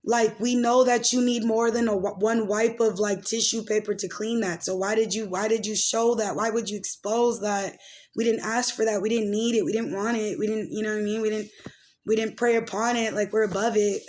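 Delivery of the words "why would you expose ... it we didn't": normal talking voice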